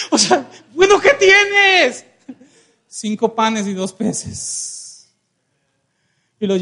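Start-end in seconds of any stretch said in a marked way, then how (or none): 0.1-2.1 high-pitched exasperated outburst; acted impatience, pitch leaping up; mock exasperation. Questioner fed up with preacher's string of noes, high mock exasperation.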